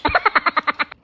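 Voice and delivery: short burst of laughter; laugh at own slip. Real, not acted. Laughs after ranch slip.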